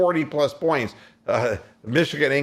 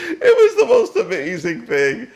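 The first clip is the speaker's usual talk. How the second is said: higher and louder through real laughter; laughing; 13 semitones higher, 7 dB louder than usual. Real, not acted. Host laughs recalling the ugly game-winning shot. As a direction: gush about it while still laughing.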